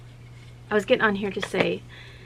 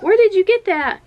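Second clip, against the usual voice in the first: higher, louder eager question; excited asking; 12 semitones higher, 11 dB louder than usual. Retold or imitated. Mother to her daughter asking where, high, loud and eager. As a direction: ask eagerly where she found them. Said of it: "And I was like".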